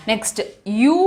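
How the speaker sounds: usual speaking voice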